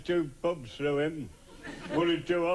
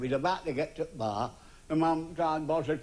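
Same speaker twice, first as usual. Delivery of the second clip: normal talking voice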